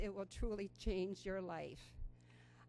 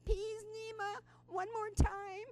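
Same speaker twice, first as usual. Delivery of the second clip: put-on much higher, slow, pouting little-girl voice; pouty toddler plea; 13 semitones higher, slower than usual. Pouting toddler begging Nima for one more run, high slow child voice. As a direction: drag it out like a sulky three-year-old begging.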